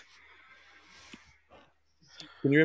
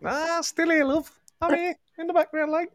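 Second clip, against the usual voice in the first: high-pitched, shaky attempt at Scouse; attempted Scouse accent; 12 semitones higher than usual. Host echoing the caller still on the line, mock Scouse accent. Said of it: "thought you were gonna do a Scouse accent".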